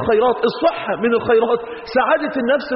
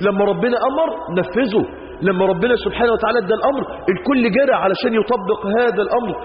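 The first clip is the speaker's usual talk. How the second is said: normal talking voice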